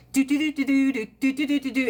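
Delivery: high, rapid do-do-do of someone who can't sit still; impatient fidgety humming; restless chatter. Retold or imitated. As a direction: buzz through it like you're itching to move. Mimics her restless impatience.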